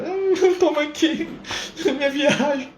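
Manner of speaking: high and slow, giggling through it; laughing. Pretend. Imagining keeping the money for his trip, laughing. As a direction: savour the naughty idea with a laugh.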